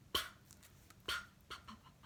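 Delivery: soft voiceless P pops; tiny puffs speeding up; popcorn popping. Shown as a demonstration. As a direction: pop the lips like corn popping. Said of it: "Sounds like popcorn".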